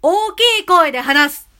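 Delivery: loud demo. Shown as a demonstration. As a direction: project it out loud. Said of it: "強弱".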